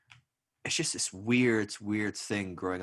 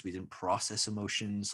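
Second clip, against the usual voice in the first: ordinary talk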